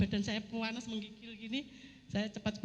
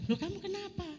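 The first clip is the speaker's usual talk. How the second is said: imitating a puzzled husband, higher pitch; retold startled question, pitched up; bingung; 9 semitones higher than usual. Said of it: "malah dia bingung lihat saya". (menirukan suami yang kebingungan)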